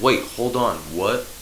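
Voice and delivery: a startled double-take; confused zebra. Acted.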